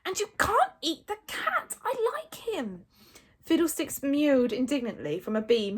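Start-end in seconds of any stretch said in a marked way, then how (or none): 0.0-2.8 high-pitched exasperation; high, fed-up protest; Hiccup losing his calm. A narrator's reading. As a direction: insist in a raised, strained pitch. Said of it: "feeling all his calmness deserting him".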